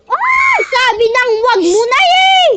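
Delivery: high, loud exasperated shout; frustrated yell. Real, not acted. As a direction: yell at them for not listening. Frustrated at friend ignoring warning.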